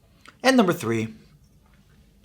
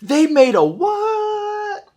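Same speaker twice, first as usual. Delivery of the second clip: shocked; 5 dB louder, much slower than usual. Acted. Acted shocked reaction to AK.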